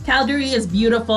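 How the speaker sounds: ordinary talk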